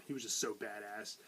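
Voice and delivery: normal talking voice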